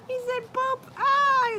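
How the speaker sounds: high, squealing giggle through the words; giggling. Real, not acted. Laughing at a viewer's "Poop" comment, high and giggly.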